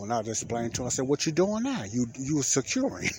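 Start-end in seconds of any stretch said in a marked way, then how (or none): 1.1-3.1 higher-pitched sarcastic retort. Retold or imitated. Answering the young officer's question, sarcastic. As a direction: answer as if it were obvious, with mock disbelief. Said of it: "I said it in a kinda sarcastic way".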